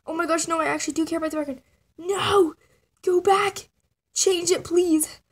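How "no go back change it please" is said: loud, high panicked pleading; yelling at the app to undo; panicked shout. Real, not acted. Kid yelling at the screen to undo the change.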